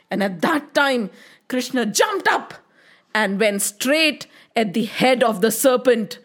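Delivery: plain reading voice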